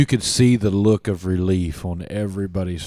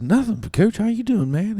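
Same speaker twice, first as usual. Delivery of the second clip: put-on higher, eager young voice; playing his younger self; 12 semitones higher than usual. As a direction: answer coach like a teen. Retold own young reply.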